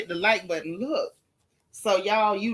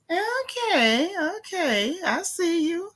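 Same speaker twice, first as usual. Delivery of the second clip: sassy okay; 7 semitones higher, slower than usual. Real, not acted. Reacting to viewer.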